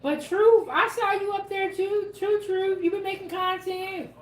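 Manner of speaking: normal talking voice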